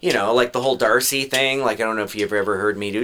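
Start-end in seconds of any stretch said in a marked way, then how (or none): none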